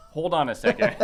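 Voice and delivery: laughing through the words in disbelief; half-laughing, stunned. Retold or imitated. Shocked by huge bill, laughing.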